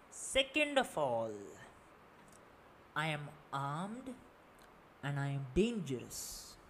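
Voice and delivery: evil villain speech. Acted. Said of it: "Continuing with my evil villain speech".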